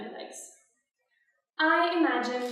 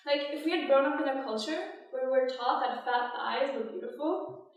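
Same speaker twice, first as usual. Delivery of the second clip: ordinary talk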